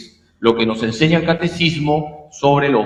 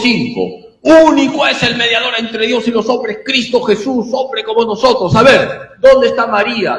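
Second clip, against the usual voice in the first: mildly higher, pushy quoting of the verse; lightly voiced evangelical demanding an answer; challenging evangelical; 6 semitones higher than usual. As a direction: recite it like a dare. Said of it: "Te lo citan de memoria".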